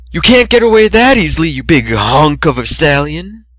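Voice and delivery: high loud teasing call through the door; a hint of a crazed admirer; lovestruck pursuer. A narrator's reading. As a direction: taunt him through the door. Lovestruck unicorn pounding door.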